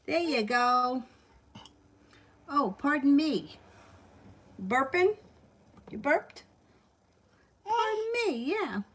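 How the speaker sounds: high, slow, playful pardon me. Real, not acted. Mother teasing the burping baby in high baby talk.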